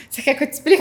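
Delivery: laughing through the question. Retold or imitated. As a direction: tease him while laughing and half crying. (rindo e meio chorando)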